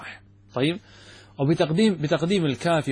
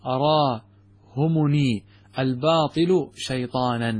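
slow quotation; much slower than usual. Shown as a demonstration. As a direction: quote read very slowly. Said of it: "يقول ابن الأثير".

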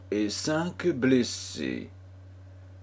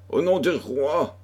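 raised-pitch official challenge; cried command; 7 semitones higher than usual. A narrator's reading. Armed man bursting into the room, crying out a command. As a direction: call it out as an armed arrest. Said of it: "l'un d'eux cria".

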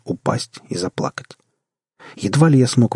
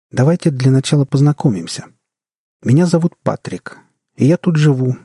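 higher, lightly voiced boyish character; friendly old man-child; 5 semitones higher, 4 dB louder than usual. A narrator's reading. Eleven-year-old with an old man's looks greets newcomers, friendly higher voice. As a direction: introduce yourself in a higher child-like voice.

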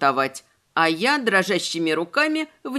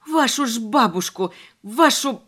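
yelling. A narrator's reading. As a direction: bellow it through the house. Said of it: "проорала я на весь дом".

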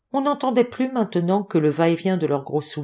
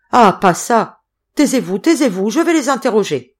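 loud, raised-pitch orders to hush; agitated shouting; 5 semitones higher, 7 dB louder than usual. A narrator's reading. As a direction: shout for silence, urgently. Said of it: "cria-t-il". (cris impérieux et affolés)

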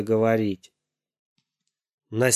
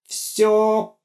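suffering exclamation; 10 semitones higher than usual. Acted. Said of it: "страдальчески воскликнул Гоша".

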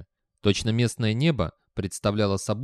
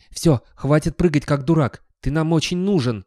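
louder, higher, impatient telling-off; exasperated scolding; 5 semitones higher, 6 dB louder than usual. Acted. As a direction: tell off a bouncing fool. Dog scolds bouncing dog.

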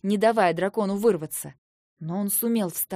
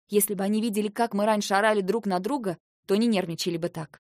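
plain reading voice

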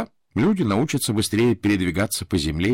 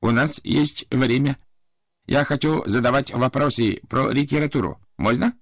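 put-on Japanese accent; 5 semitones higher than usual. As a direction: ask eagerly like a smiling Japanese servant. Japanese servant asks eager questions. (японский акцент, радушно)

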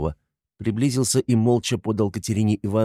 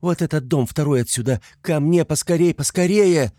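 high, urgent begging, growing louder; pleading; 8 semitones higher, 4 dB louder than usual. A narrator's reading. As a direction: implore them to hurry. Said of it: "умоляла Соня". (умоляет, всё громче и настойчивее)

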